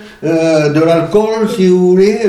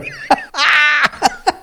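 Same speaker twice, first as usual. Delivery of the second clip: high burst of laughter; real high-pitched giggling fit; 11 semitones higher, 3 dB quieter than usual. Real, not acted. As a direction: laugh at yourself, high and helpless. Amused at own accent.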